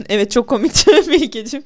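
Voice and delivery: loud words broken by laughter; laughing while talking. Real, not acted. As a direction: laugh through the line.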